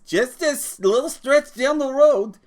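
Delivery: high mocking squeak of the driver's words; mocking the bus driver. George mocks the bus driver to Lenny, high and sneering. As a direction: ape the lazy bus driver in a silly high voice. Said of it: "and says".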